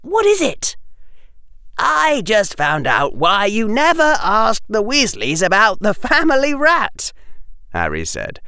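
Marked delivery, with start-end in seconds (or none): 0.0-0.8 high-pitched schoolgirl question; girl character voice. A narrator's reading. Hermione asking Harry what it is, high voice. 1.8-7.1 high, loud, giggly delivery; giggling helplessly. A narrator's reading. Harry telling Hermione about the rat, giggling helplessly. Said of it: "Harry start giggling helplessly".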